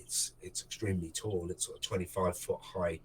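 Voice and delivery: ordinary delivery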